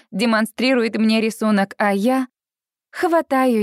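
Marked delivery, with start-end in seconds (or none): none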